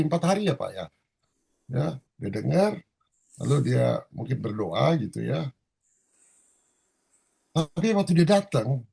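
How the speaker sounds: usual speaking voice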